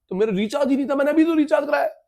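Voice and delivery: high-pitched, hurried bickering; mock protest. Child and parent argue over phone recharge, mock protesting. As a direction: argue back lightly, voice climbing.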